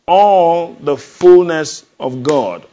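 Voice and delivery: slow, loud, drawn-out proclamation; booming slow sermon climax; proclaiming again. Acted. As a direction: lean into ALL and stretch it. Preacher repeats proclamation.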